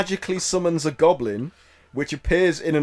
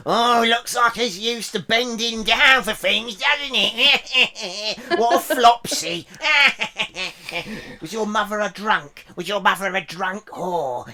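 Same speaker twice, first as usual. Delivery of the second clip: lightly higher, laughing goblin taunt; mocking goblin; 3 semitones higher, slower than usual. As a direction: taunt him like a gleeful goblin. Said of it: "he's like".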